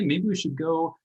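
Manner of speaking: normal talking voice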